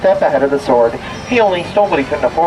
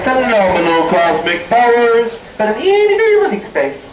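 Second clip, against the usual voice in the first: genie voice; 7 semitones higher than usual. Pretend. Quoting the genie's line.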